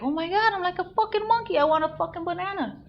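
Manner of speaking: very high wailing complaint that winds down; hammed-up, high-pitched indignant whine; ranting tantrum. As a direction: play an aggrieved performer demanding a reward. Acting herself as an aggrieved monkey.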